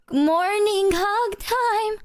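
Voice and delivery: high, slow, sing-song morning hug time; cooing hug plea. Retold or imitated. Boyfriend imitating himself luring girlfriend back to bed, high and slow. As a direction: drag it out sweetly to lure her back. Own coaxing quoted again.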